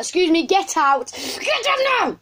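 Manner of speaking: hammed-up order to leave; mock shop owner yell. Pretend shop owner throwing the kids out, mock high yell. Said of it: "no, I'm joking, she wasn't like that".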